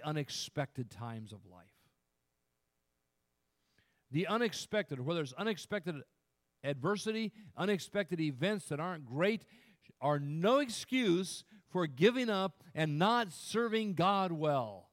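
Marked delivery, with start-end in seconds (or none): 4.1-14.9 raised voice, hammering each point; emphatic preaching. Real, not acted. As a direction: exhort the congregation with force. Preaches at full force.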